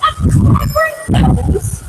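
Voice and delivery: high slow hissed question. A narrator's reading. Mother hissing at Jack, high and slow. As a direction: hiss it back disbelieving. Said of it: "his mother hissed".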